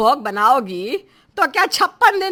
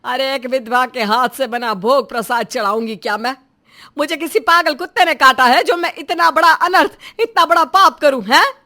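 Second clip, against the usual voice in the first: outraged rant; 5 dB louder than usual. Acted. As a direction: build to a loud, affronted question. Mother-in-law rants at widow's offering. (गुस्से में भड़कते हुए)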